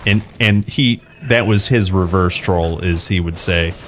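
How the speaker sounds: ordinary talk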